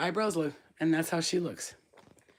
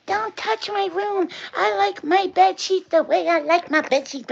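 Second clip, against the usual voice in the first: high, loud whiny mimicry of a mad kid; hammed-up high-pitched protest; mock-angry daughter; 12 semitones higher, 6 dB louder than usual. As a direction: imitate your daughter throwing a fit. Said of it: "she gets all mad at me".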